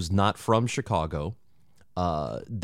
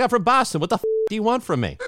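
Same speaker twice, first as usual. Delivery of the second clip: high, fast mock-belligerent Boston accent; 13 semitones higher, much faster than usual. Host imitates a Bumble date from Boston, combative Boston accent. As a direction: put on an indignant Boston guy's accent. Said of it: "That's exactly how they talk".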